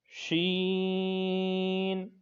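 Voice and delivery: شین stretched very long; long sheen. Shown as a demonstration. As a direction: hold the شین hush slowly. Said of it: "اور اس کو لمبا کریں گے". (شین لمبا کھینچ کر)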